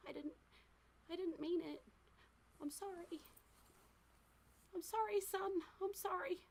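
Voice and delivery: high, tearful apology; crying. Acted. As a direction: plead through tears, high-pitched. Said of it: "you just hear quiet crying".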